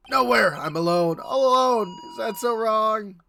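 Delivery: loud, high wail of self-pity; anguished outburst. Acted. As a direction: cry out to the rooftops that you're all alone. Lonely officer bursts out.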